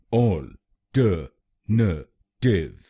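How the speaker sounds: Alternative drawn out very slowly, syllable by syllable; very slow model. Shown as a demonstration. Teacher slows the word right down for learners. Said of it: "तो बनेगा".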